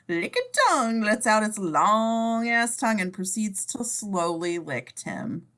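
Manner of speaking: usual speaking voice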